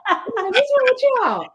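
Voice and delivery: high, drawn-out baffled question, laughing; mock bewilderment. Diner retelling her oblivious moment for laughs, mock bewilderment. Retold puzzlement, told for laughs.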